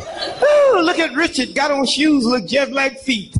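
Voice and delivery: high, laughing gush; hammed-up delighted "oh, look at Richard"; mock admiring neighbor. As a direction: coo over the costume. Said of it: "everybody thought you was dressed".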